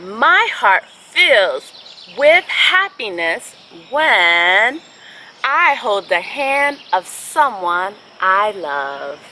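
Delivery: slow, gentle storybook reading; picture-book read-aloud with long pauses; reading to children. Acted.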